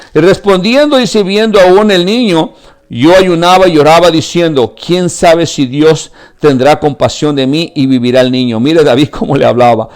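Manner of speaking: high, loud, impassioned sermon; preaching at full force. Acted. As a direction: pour it all out to the congregation. (predicación a todo pulmón, aguda y fuerte)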